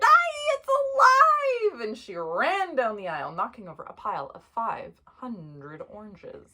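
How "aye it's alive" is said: high, loud, drawn-out shriek of horror; hammed-up panicked wail; scream. A narrator's reading. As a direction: scream as if a doll came to life. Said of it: "The lady screamed".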